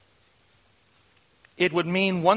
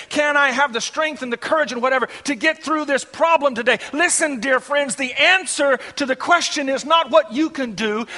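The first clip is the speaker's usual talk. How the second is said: higher, urgent pulpit delivery; impassioned preaching; 8 semitones higher, 7 dB louder than usual. Real, not acted. Preacher voicing the flock's daily struggles, impassioned preaching. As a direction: drive each question on with rising urgency.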